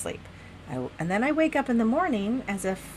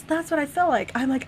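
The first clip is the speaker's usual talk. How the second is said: usual speaking voice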